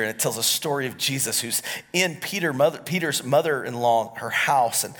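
ordinary talk, nothing marked

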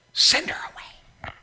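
hushed, slow plea to send her off; urgent whisper. Retold or imitated. Disciples whispering to Jesus, hushed and urgent. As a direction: lean in and whisper it.

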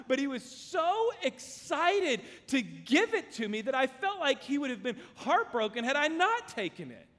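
ordinary talk

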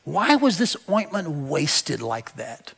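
slow, soft, resentful grumble under the breath; disapproving murmur in a crowd; indignant muttering. Acted. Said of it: "said to themselves indignantly".

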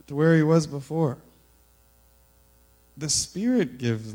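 scripture reading. Acted. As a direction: proclaim the passage to the congregation. Reads scripture passage aloud.

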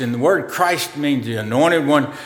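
ordinary delivery

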